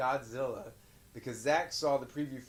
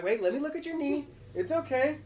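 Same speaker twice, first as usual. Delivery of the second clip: reassuring parent; 12 semitones higher, 3 dB louder than usual. Retold or imitated. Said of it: "I was like".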